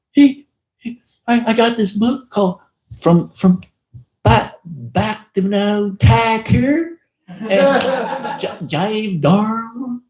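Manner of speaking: high, stammering, awed retelling; breathless wonder. Retold or imitated. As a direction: tell it like a miracle just happened. Older man retelling his vision.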